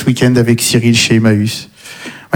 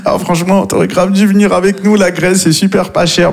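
mock bragging friend; 5 semitones higher than usual. Friend bragging about holiday.

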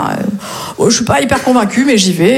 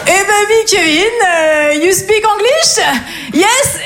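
mock patronising teacher; 8 semitones higher, much slower than usual. Teacher mocks pupil in class.

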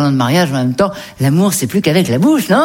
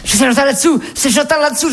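high-pitched mock holler; imitated yelling; 9 semitones higher than usual. Man yelling from the back of his flat, high mock holler. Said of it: "Il a hurlé, il était au fond".

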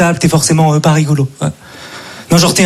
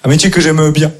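ordinary talk

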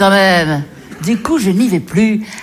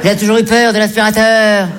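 ordinary talk, nothing marked

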